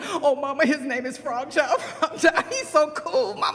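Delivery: put-on high, giggly child snake; gush, breaking into laughter; excited child. Snake Son tells Mama about Frog Child, giddily.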